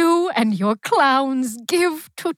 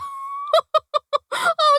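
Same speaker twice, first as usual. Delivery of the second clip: incredulous high laugh. Acted.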